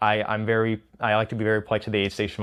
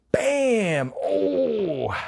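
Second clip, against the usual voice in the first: much higher sharp yelp of pain; mock the shock of pain hitting; sudden pain cry; 11 semitones higher than usual. Runner reenacts the pain striking, a high yelp.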